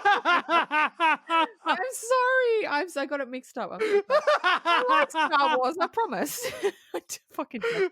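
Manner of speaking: high, giggling sorry; laughing apology. Real, not acted. Apologizing to Star Wars fans while laughing high.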